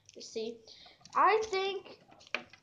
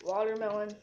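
ordinary talk

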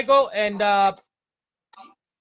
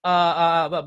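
ordinary delivery

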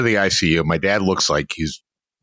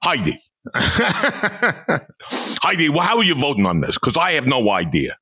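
put-on higher, fast, shouting old-senator bluster; Bernie Sanders; 5 semitones higher than usual. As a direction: lob the question like a flustered Bernie Sanders. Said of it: "he did the Bernie Sanders".

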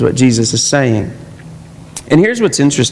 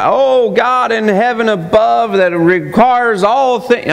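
slow, high churchy prayer voice; mock pompous prayer voice; 6 semitones higher, slower than usual. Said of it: "get the prayer voice".

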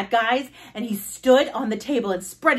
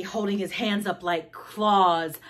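plain reading voice

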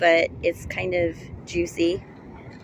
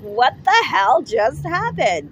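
slow, loud, high shocked question; stunned after the crash; dazed disbelief; 6 semitones higher, 7 dB louder, slower than usual. Retold or imitated. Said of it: "I'm like".

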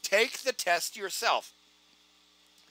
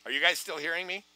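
normal talking voice

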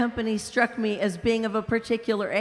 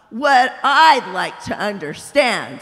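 big ghost, indignant; 6 dB louder than usual. Acted. Big ghost shouting at the bright spirit, loud and outraged. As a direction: bellow it like an aggrieved bully.

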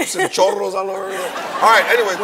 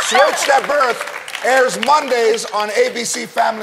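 slow, loud plug read; show announcer; 3 semitones higher, 4 dB louder than usual. Acted. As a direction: introduce the star like a TV host. Plugging the show.

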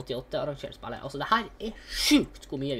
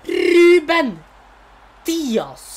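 high, loud, stretched cry; excited shout; 12 semitones higher, 15 dB louder, much slower than usual. Real, not acted. Gamer shouting the player's name as the card appears.

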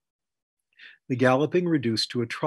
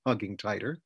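ordinary delivery, nothing marked

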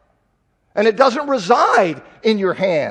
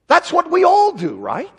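higher, louder fervent preaching; preaching at full force; 6 semitones higher, 4 dB louder than usual. Real, not acted. Preacher urges believers to confess sin, fervent and rising. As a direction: drive each clause home.

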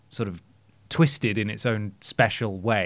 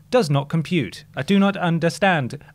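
flat robot voice; 6 semitones higher, 3 dB louder than usual. Retold or imitated. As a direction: speak like a malfunctioning robot. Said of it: "The robot says back to you".

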